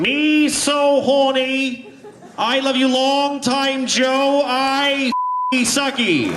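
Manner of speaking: high, slow Boston-tinged mock accent; JFK as Saigon prostitute. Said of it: "John F. Kennedy is a prostitute in Saigon".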